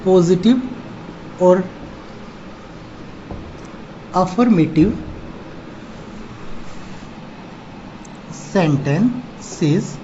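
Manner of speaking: word by word, with long pauses; slow dictation. Real, not acted. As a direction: dictate very slowly, leaving time to write each word. Teacher dictating notes to write down.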